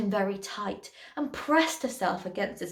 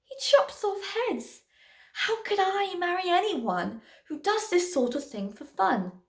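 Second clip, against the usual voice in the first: heartbroken; 6 semitones higher than usual. A narrator's reading. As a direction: lament it in wounded disbelief. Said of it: "Poor Cindy's heart was torn to shreds".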